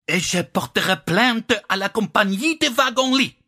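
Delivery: high, voluble French outrage; indignant. A narrator's reading. Slavic lady threatens a complaint in French, indignant. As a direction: rail in French in a high, affronted voice.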